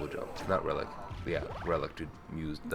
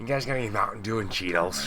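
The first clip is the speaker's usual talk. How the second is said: lisping gamer nerd, higher; playful put-on nerd voice; nerdy lisp voice; 5 semitones higher than usual. Mocking nerd asks for snacks.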